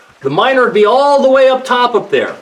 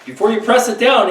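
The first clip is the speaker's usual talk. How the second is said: normal talking voice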